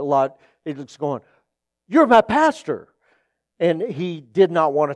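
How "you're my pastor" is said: high, loud delighted greeting; excited recognition. Retold or imitated. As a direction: imitate a churchgoer thrilled to spot the pastor. Woman recognizes pastor at airport.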